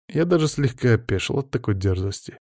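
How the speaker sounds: usual speaking voice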